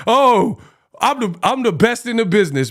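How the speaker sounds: ordinary talk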